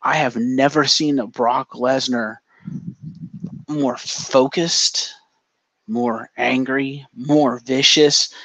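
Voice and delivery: each phrase slow and weighted; slow menacing list; slow, dark build. Retold or imitated. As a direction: lay out every word slow and heavy, like a threat. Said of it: "you can just see this darkness in his eyes".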